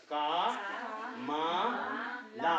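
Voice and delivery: का, मा, ला each said alone with pauses; syllable by syllable. Shown as a demonstration. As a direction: break का मा ला into separate beats. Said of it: "अकेला अकेला कैसे बोला था".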